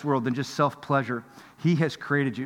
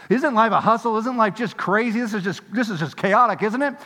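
weary complaining; 6 semitones higher, 3 dB louder than usual. Retold or imitated. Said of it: "those are the kind of words we say".

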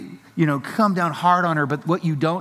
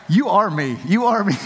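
mocking son; 5 semitones higher, 4 dB louder than usual.

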